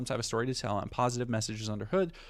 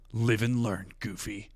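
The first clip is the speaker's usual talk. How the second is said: Shadow voice; 2 semitones lower, slower than usual. Shown as a demonstration. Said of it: "I gotta do a Shadow voice".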